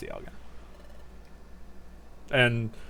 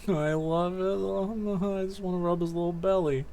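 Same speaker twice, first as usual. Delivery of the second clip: sustained high cooing; adoring coo; 4 semitones higher, 3 dB quieter than usual. Real, not acted. Player wanting to rub its little belly, high adoring coo. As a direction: gush softly over something adorable. Cooing over a cute Pokemon.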